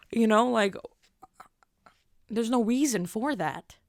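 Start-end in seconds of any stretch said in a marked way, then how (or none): none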